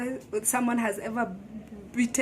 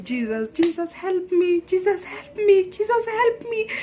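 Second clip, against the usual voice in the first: pleading call climbing higher and louder; desperate cry for help; 8 semitones higher, 6 dB louder than usual. Retold or imitated. Beaten wife crying out to Jesus for help, desperate and rising. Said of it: "I remember just calling".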